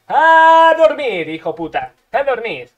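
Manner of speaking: victorious gamer shout; triumphant yell. Real, not acted. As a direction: belt it out like a knockout call. Finishing move taunt.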